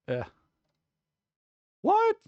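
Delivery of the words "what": high yelped what; sudden high what; surprised. Real, not acted. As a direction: caught off guard. Surprised by a donation.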